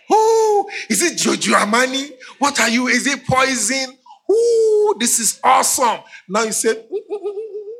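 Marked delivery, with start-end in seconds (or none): 0.1-6.0 high hammed-up gushing husband; mock gushing praise. Husband mocking perfume praise. 6.7-7.7 disgusted hum. Retold or imitated. Disgusted pregnant wife hums.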